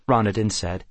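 ordinary delivery

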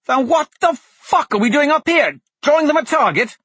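high frantic shouting; panicked yell. Acted. Blaze panicking under attack.

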